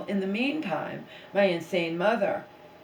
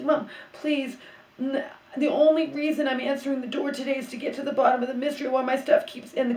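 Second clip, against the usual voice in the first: exasperated plea; 8 semitones higher, 5 dB louder than usual. Retold or imitated. Pleading with demanding mother.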